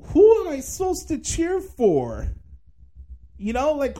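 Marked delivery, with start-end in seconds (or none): 0.0-2.3 exasperated inner outburst. Retold or imitated. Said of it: "I'm like".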